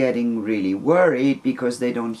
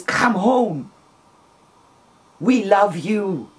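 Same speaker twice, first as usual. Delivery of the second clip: high, urgent plea to come home; pleading; 7 semitones higher, 3 dB louder, much slower than usual. Retold or imitated. Friend on the phone urging a lost woman to come home, pleading. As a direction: beg her gently but urgently to return.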